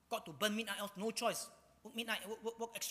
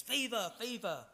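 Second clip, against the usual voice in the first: mild shouts; 4 semitones higher than usual. Acted. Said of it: "to shouts of".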